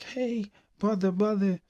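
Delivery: high-pitched calling out to a brother; imitated child's call; little sister calling. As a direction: call after him like a kid sister, voice lifted high.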